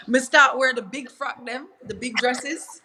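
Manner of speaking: Jamaican patois, lightly rising. Real, not acted. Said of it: "my Jamaican accent coming out".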